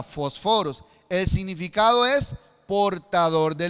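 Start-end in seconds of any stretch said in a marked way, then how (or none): 0.0-0.8 "fósforos" low, stressed as fosFÓros; Greek pronunciation. Shown as a demonstration. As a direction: say "fósforos" with the second o accented. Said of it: "esa es la palabra". ("fósforos" a la griega, con acento en la segunda o)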